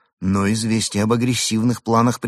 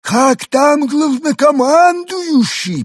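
high, slow alien warble; drawn-out otherworldly voice; 9 dB louder, much slower than usual. A narrator's reading. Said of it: "поинтересовался Пингвин". (высокий медленный голос пришельца)

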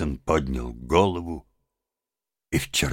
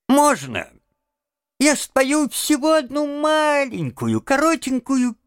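very high-pitched pleading voice; plaintive plea; 3 dB louder than usual. A narrator's reading. Toad begging his friends for one little song, plaintively. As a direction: beg pitifully, way up high. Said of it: "жалобно попросил он".

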